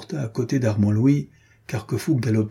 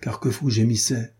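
usual speaking voice

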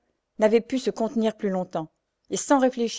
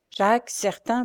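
ordinary delivery, nothing marked

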